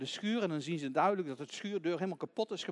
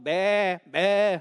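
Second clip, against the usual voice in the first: loud imitated lamb bleat; lamb's bleat; 13 dB louder than usual. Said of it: "hoort ze hem al".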